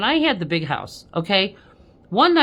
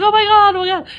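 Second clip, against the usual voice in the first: much higher, loud terrified squeal; high panicked cry of fright; imitating panic; 13 semitones higher, 9 dB louder, faster than usual. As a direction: play the frightened man nearly falling off the porch. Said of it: "And he was like".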